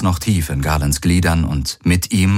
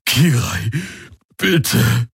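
strained plea, higher pitch; forced out; 5 semitones higher, much slower than usual. A narrator's reading. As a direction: plead through pain, word by word. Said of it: "brachte der Aur hervor". (mühsam und flehend hervorgebracht)

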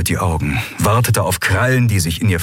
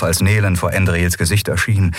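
usual speaking voice

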